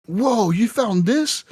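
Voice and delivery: high, drawn-out amazement; acted astonishment. Quest giver shown the mystery liquid, acting amazed. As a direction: marvel at it slowly, pitch swooping up.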